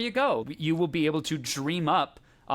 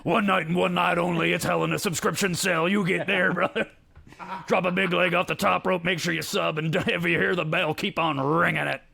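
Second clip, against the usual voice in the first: wrestling promo; 2 semitones higher than usual. Acted. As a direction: sell it like a pay-per-view promo. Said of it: "wrestling impression".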